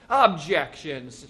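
loud, drawn-out courtroom objection; attorney's ringing shout. A narrator's reading. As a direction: call it out slowly across the court. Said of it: "shouted Mitty's attorney".

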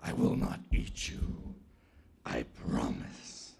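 very deep, hushed rasp; leopard promising. Acted. Leopard promises not to eat Anansi, deep and soft.

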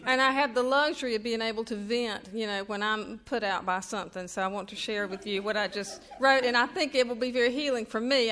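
ordinary talk

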